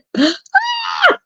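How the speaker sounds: high, squealing laugh; a helpless shriek of laughter. Real, not acted. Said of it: "I'm over here choking".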